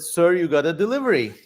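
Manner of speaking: ordinary talk, nothing marked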